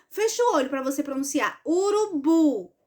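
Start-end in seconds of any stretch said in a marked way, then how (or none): 1.6-2.7 "Urubu" slowly, BU held long; stressed last syllable. Shown as a demonstration. Teacher asking pupils to listen, "Urubu" stressed on BU. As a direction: draw out "Urubu" toward a strong BU. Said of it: "Qual foi o pedaço mais forte?".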